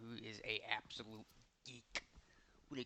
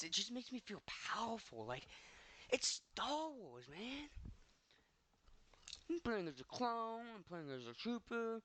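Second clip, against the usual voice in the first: excited; 9 semitones higher than usual. Real, not acted. Gushing about playing Star Wars.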